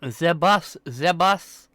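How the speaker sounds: "The bus" as "ze bus" in a bad German accent. Streamer mimics friend's word, in a bad German accent. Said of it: "I'm talking like a bad German accent".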